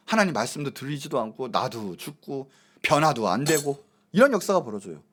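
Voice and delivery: ordinary talk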